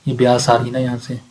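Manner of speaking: ordinary talk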